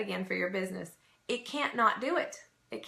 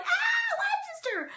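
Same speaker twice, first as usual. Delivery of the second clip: high squealing shout of delight; sky-high excited greeting shout; slower than usual. Retold or imitated. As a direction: squeal like you've spotted a long-lost sister. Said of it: "we're like".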